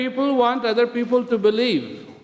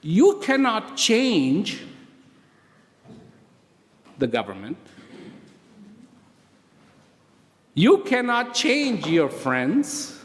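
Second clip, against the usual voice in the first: slow emphatic preaching; slower than usual. Acted.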